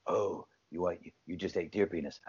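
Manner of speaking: low, soft smug taunt; mocking sneer. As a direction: sneer it quietly. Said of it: "But instead it's like".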